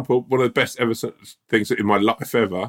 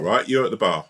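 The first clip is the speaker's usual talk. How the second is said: ordinary delivery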